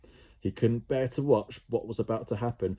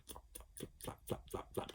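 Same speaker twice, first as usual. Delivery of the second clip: flapping whisper; 18 dB quieter than usual. A narrator's reading. Wing sound.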